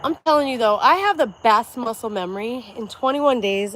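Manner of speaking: loud, pumped-up boast; hyped up. Real, not acted. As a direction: pump yourself up, loud. Hyped up while working out.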